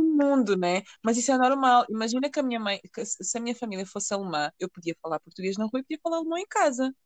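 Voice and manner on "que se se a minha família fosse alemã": ordinary talk, nothing marked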